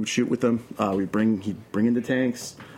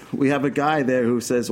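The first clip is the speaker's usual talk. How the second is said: usual speaking voice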